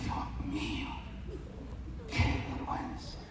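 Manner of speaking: very low, near-whispered plea; hushed mock-desperate prayer. Preacher retelling his prayer on aching knees, hushed desperate plea. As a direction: plead under your breath, slowly.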